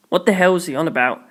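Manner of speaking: plain reading voice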